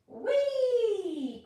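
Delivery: high, loud squeal of delight; gleeful cry. Acted. Tiny spiderling flying through the air, gleeful high squeal. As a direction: squeal it like a tiny creature having fun. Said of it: "cried a little voice".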